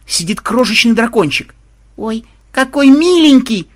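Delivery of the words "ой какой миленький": high, loud, drawn-out cooing cry; delighted squeal. A narrator's reading. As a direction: squeal over something adorable. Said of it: "тут же завопила внучка". (восторженный вопль внучки)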